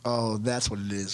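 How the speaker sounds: ordinary delivery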